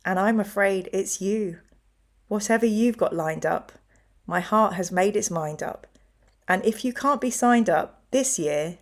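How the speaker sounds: soft, measured poem reading. Acted. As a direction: read the verse gently to the audience. Reads Wendy Cope poem aloud.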